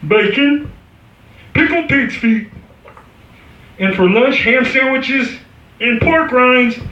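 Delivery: frog anchorman reading the headlines; Kermit news report. Retold or imitated. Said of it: "He'd be doing the news report".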